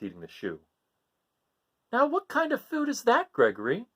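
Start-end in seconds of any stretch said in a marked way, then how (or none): 1.9-3.9 high puzzled mother voice; Mother Goat puzzled. Acted. Mother asking her son about his food, high and puzzled. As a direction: ask it baffled and high. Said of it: "she said".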